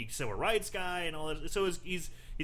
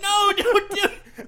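high, loud and slow, begging through laughter; laughing plea; 10 dB louder, slower than usual. Retold or imitated. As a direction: mock-desperate warning, laughing. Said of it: "I'm like".

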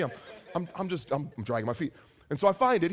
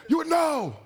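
angry yelling; 10 semitones higher than usual. Retold or imitated. Angry barista yelling at a customer over a review, shrieky. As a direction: act out an outraged barista yelling. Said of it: "she starts yelling".

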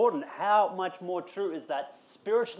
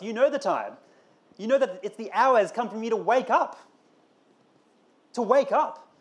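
rising, louder preacher's call to wake up; preaching; 6 semitones higher, 5 dB louder than usual. Acted. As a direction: build it up and lift the congregation.

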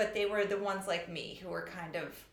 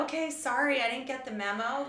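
higher, louder mock-sorry retort; retold sarcastic comeback; sarcastic apology; 5 semitones higher, 4 dB louder than usual. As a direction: brush off the scolding with sarcasm. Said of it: "And I was like".

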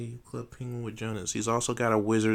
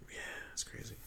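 whisper; 14 dB quieter, faster than usual. Real, not acted. Sarcastic aside.

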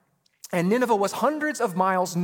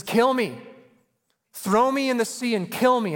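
louder, higher mock plea to die; Jonah's plea; 6 semitones higher, 5 dB louder than usual. Pastor voicing Jonah pleading to be thrown overboard, louder and higher. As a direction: play Jonah begging them to kill him. Jonah quoted begging death.

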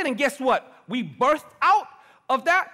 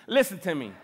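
usual speaking voice

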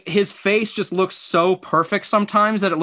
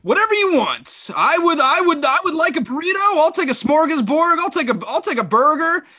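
Kevin Harlan ordering; 11 semitones higher than usual. Pretend. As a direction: order a table like you're calling a game. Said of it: "you're Kevin Harlan".

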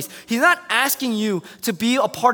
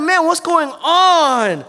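higher, louder, dragged out; slow exasperation; 8 semitones higher, 7 dB louder, much slower than usual. Retold or imitated. Fed-up friend at the messy wedding, drawn-out exasperation. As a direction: groan the question in despair. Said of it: "And I'm like".

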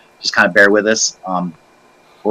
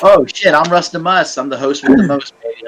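hyped up; 7 semitones higher than usual. Acted. Hyped self-introduction as host.

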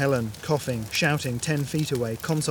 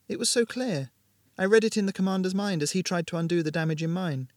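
Ramona, calm and resigned; 5 semitones higher than usual. Acted. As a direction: explain your choice without fear. Ramona speaks as a character.

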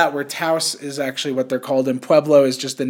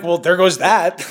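laughing. Pretend. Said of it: "I'm like".

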